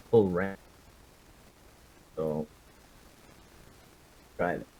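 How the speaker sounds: ordinary talk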